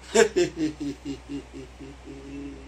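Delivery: long trailing chuckle; laughter. Real, not acted. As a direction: laugh on and on, letting it fade. Laughs alone between remarks.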